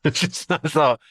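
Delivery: ordinary delivery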